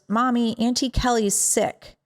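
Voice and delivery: a hint of a two-year-old's voice; little niece. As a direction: imitate a small child telling mommy. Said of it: "my little niece was, like, two and a half".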